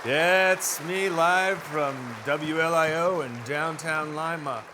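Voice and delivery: ordinary delivery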